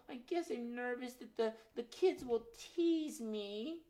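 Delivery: high, slow, timid critter voice; shy little muskrat voice, drawn out; high timid puppet muskrat; nervous puppet. Acted. Puppet Gus speaks nervously.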